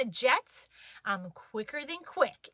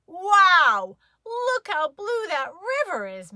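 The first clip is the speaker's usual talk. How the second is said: Daddy's voice; 7 semitones higher, 6 dB louder, slower than usual. A narrator's reading.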